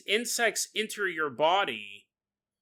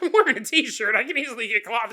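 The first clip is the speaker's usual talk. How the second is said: indignant retort; 5 semitones higher, 5 dB louder, faster than usual. Acted. Victim mocks the witch, comic quarrel.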